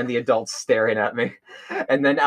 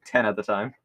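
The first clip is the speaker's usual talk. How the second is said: ordinary talk, nothing marked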